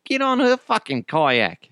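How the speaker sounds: impatient Australian boatman, imitated; kayak rental guy. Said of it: "the guy that sold us the kayaks".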